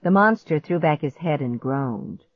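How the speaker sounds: ordinary delivery, nothing marked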